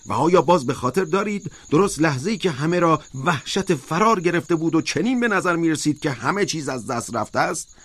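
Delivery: high, emphatic protest; insistent high-pitched oration, not a full shout; crying out. A narrator's reading. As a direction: argue it high and forcefully, like a rousing speech. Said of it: "اسکویلر فریاد کشید".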